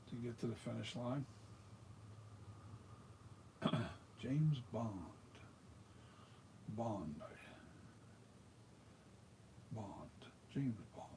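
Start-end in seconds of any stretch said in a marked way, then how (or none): 4.2-11.1 attempted British spy accent. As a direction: try a British James Bond, not very well. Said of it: "I'm terrible with that accent".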